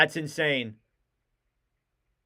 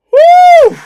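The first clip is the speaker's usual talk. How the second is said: loud, high cheering whoop; 14 dB louder than usual. Real, not acted. Fan whooping after a big save, loud and high. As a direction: let out a joyful whoop.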